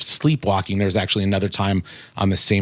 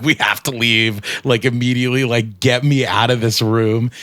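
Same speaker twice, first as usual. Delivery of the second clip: retold panicked shout; 3 semitones higher, 3 dB louder than usual. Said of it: "started screaming".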